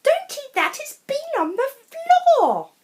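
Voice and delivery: high loud frantic mum; alarmed mum crying no. A narrator's reading. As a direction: shriek it like a panicked mother. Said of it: "she cried".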